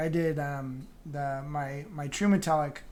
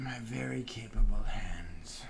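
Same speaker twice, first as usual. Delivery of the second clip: whisper; 4 semitones lower, 5 dB quieter, slower than usual. Acted. Jokey aside while host leaves.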